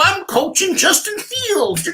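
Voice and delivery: high, slow mock boast; a lightly voiced pompous counselor; mocking Dalton to Eddie, high and slow; mock smug coach. Mocking Dalton's self-belief.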